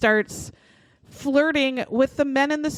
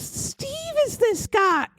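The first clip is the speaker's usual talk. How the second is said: much higher and slow, each word pressed out; stunned insistence; 12 semitones higher, slower than usual. Retold or imitated. Woman telling her husband the TV fugitive is her sister's boyfriend, high and slow. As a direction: hammer it home in a high, amazed voice. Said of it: "So she goes".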